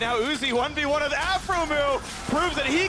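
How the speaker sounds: high-pitched climactic esports call; excited play-by-play. Real, not acted. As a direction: build the duel to a high, stretched finish.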